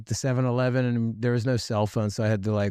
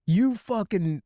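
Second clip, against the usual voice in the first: high, exasperated scolding; much higher retold scolding from friends; 7 semitones higher than usual. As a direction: lay into him for vanishing. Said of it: "then they're like".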